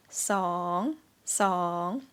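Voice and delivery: song dips low and rises; Thai rising tone. Shown as a demonstration. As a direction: scoop song down then up. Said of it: "rising tone".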